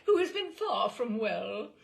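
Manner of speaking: high, loud society lady through laughter; high fluting commanding matron; fluting upper-class Englishwoman. Retold or imitated. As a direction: lay on the grand dowager voice. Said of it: "fluting tones of a middle-aged, upper-class Englishwoman".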